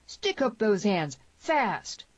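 high, drawn-out barked command; barked order. A narrator's reading. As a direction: bark it like a hold-up. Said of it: "voice of Tierney barked".

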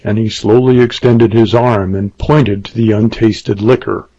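ordinary delivery

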